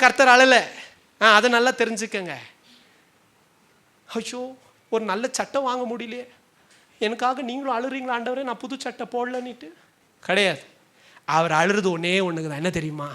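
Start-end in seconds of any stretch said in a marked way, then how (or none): 4.1-9.7 high, soft put-on whimper; mock self-pity. Believer asks God about a new shirt, in mock self-pity. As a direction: lament feebly, sending up the complaint.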